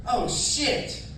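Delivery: shocked. Retold or imitated. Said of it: "And I was like".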